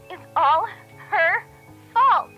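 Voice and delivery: high, loud shriek word by word; shrieking blame. A narrator's reading. Recorded Chloe shrieking that it's all her fault. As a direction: scream each word of the blame. Video clip, Chloe shouting.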